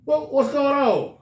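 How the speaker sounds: dad asking why his son is at school so late; confronting dad. Retold or imitated. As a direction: demand an answer from the son.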